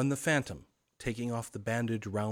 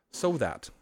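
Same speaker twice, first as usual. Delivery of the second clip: usual speaking voice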